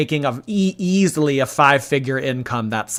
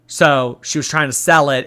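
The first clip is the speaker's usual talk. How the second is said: normal talking voice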